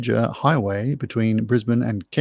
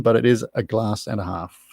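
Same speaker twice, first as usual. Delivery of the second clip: ordinary talk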